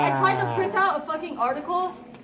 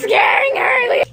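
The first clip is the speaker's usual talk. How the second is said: loud laughing shout; 7 semitones higher, 8 dB louder than usual. Real, not acted. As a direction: yell it out over the noise. Shouted over chaos.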